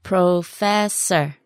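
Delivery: "Professor" drawn out syllable by syllable; slow word model. Shown as a demonstration. Teacher modelling "Professor" for learners, very slowly. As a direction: stretch each syllable of "Professor".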